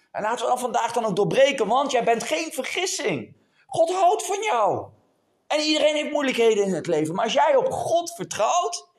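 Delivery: high, urgent exhortation; rousing sermon climax; fervent preaching. Real, not acted. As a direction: lift the pitch and preach with full conviction. Exhorts the congregation.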